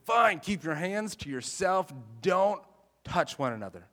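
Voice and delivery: acted fed-up parent telling kids off; exasperated parent. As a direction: lay down the rule to squabbling kids, loudly. Parent scolding fighting kids, acted.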